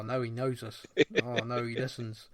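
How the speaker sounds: normal talking voice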